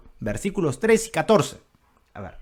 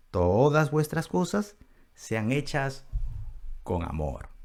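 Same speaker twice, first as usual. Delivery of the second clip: loving tone; 6 dB quieter than usual. Acted. Preacher showing the apostle's change of tone, loving. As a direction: ease into tenderness, dropping on the last words. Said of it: "Pero inmediatamente después en el versículo catorce dice".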